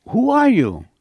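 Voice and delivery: high-pitched wondering question; curious turtle. Acted. Turtle asks the strange beaver who it is, curious and high. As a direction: ask it high and puzzled.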